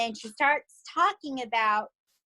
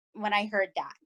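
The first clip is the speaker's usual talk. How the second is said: normal talking voice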